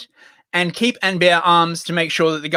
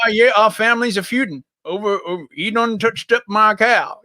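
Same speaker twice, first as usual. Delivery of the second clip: higher-pitched feuding American farmer; bad US accent; 4 semitones higher than usual. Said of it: "crap U S accent".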